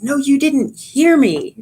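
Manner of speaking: high, slow, offended retort; hurt, insistent reply; indignant protest. Pretend. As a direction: push back as if unheard. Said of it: "they may hear it as".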